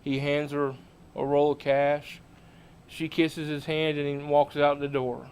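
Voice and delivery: ordinary delivery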